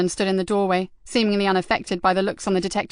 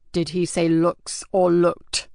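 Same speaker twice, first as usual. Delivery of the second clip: dread-filled question; 3 semitones lower, much slower than usual. Acted. Father fearing for his daughter.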